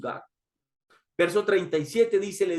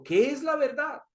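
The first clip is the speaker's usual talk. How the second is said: higher, lightly voiced Pilato wondering; Pilato's searching question; 8 semitones higher than usual. Pilato asks Jesus about truth, higher and searching. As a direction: ask it up high as a puzzled governor.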